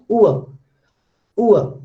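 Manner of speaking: "Ua" as a falling oo-uh glide; diphthong demonstration. Shown as a demonstration. Teacher showing how it is pronounced, falling "ua". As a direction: sound out "ua" separately, falling. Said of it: "how this is pronounced?".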